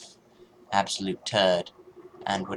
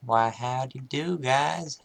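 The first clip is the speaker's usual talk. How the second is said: folksy howdy voice; 5 semitones higher, 4 dB louder, slower than usual. Pretend. Cheery greeting to enemies.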